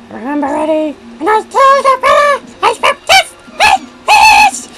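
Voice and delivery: very high, loud cartoon squeal; too-high Judge Doom voice. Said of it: "That was a bit too high pitched".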